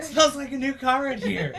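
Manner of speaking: put-on high kid voice with laughter in it; Peter Parker. Host echoes the line from the new suit, high boyish voice. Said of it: "when he gets into his new spider".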